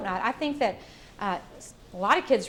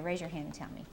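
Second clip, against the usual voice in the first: usual speaking voice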